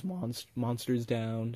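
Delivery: a real adolescent voice crack. Real, not acted. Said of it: "Sorry for the voice crack".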